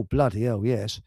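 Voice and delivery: normal talking voice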